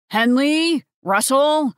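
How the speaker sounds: calling out. A narrator's reading. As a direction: call up to the kids. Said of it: "Hal's voice called up the stairs".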